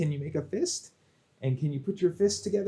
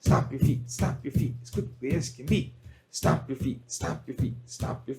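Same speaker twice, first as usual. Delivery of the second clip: fast; 2 semitones lower than usual. Shown as a demonstration. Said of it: "Now fast".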